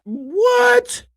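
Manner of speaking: very high stretched exclamation; high what. Real, not acted. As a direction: stretch it up in amazement. Marvelling at the dressed-up man.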